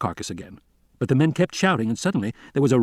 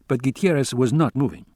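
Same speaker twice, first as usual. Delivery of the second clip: usual speaking voice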